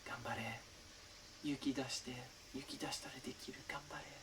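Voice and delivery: hushed Japanese encouragement, barely voiced; a boy whispering courage to a scared friend at the campfire; whispering under breath. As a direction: whisper it so only the kid next to you hears. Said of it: "whispering, under his breath".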